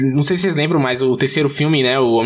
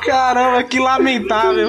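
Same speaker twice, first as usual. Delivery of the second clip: mock pity; 8 semitones higher, slower than usual. Real, not acted. Friend mocks the story.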